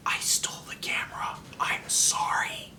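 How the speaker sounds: quick secret whisper; whispered confession. Retold or imitated. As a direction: whisper it so dad can't hear.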